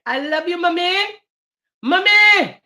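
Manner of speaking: high, rising to a loud call; calling out to mommy. Real, not acted. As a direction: call out to her with love. Calling out love to second mom.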